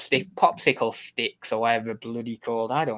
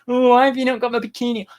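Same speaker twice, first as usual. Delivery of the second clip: whiny chat impression; 9 semitones higher, 4 dB louder, faster than usual. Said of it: "They're like".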